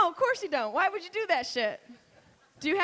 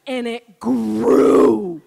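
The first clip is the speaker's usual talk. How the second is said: very slow, loud drawn-out grew; dramatic growl; 6 semitones lower, 12 dB louder, much slower than usual. Acted. Comic describing the pregnancy to a man, booming slow. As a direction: stretch it huge and ominous.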